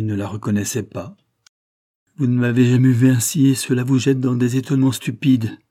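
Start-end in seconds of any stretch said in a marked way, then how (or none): 2.2-5.6 much louder, higher, vehement retort. A narrator's reading. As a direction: lash out with full force. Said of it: "poursuivit Julie avec véhémence".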